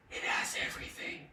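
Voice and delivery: hushed, quick whisper; quick conspiratorial whisper; whispered aside. Acted. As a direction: whisper it like a delicious secret. Aside inside acted example sentence.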